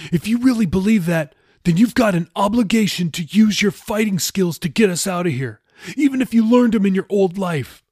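high, loud, urgent argument; Jeffy, insistent. Acted. Jeffy demands Raoul use his skills, insistent and high. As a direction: press the obligation hard.

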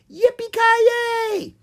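very high, long cowgirl whoop; yelled. A narrator's reading. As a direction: whoop it like a rodeo rider. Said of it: "Ella yelled".

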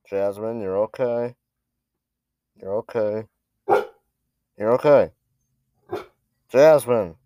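soothing the dog off-mic; calling the dog, turned away; turning away from phone. Real, not acted. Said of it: "That's my dog".

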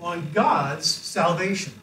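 slow call. Shown as a demonstration. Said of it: "repeat after me".

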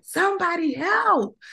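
high, tearful cry for somebody to help; tearful plea. Retold or imitated. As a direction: beg for help through tears. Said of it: "like, in tears".

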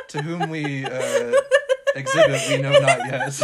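hammed-up Old English phrasing through laughter; olde speech. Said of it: "Why do I speak in Old English?".

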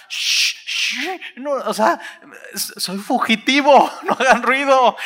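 high, laughing shush; hushing. Acted. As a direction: hush them with a laugh. Pedro hushing the disciples.

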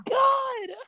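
high, strained cry of frustration; exasperated cry. Real, not acted. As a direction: groan it out in despair. Stream buffering again.

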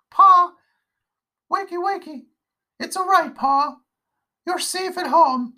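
put-on high child's voice calling urgently; a young girl trying to wake her father; little girl pleading.

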